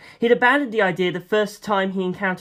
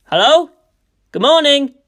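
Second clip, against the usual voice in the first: louder, higher call into the house; 8 semitones higher, 7 dB louder than usual. A narrator's reading. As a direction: call into an empty hallway. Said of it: "I called".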